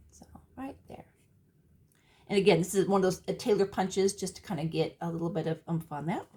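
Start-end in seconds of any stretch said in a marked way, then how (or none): 0.1-1.0 soft whispered aside; whispering. Real, not acted. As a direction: breathe it out as a whisper. Aside while showing the card.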